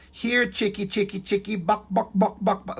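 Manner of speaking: high sing-song chicken call, lightly clucked; mocking chicken call. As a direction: tease him out like calling a hen, buck buck. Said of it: "calling somebody a chicken and imitating this".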